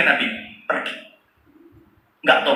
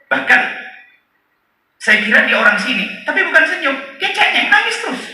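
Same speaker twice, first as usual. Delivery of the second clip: shepherd voice; 14 semitones higher than usual. Acted. Shepherd telling Umar about the crying boy, high-pitched. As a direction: let the shepherd's voice climb high as he tells it.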